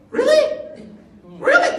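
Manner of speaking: mocking someone who forgot God's help; mocking disbelief. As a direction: throw it back at them sarcastically. Mocking a doubting believer's post.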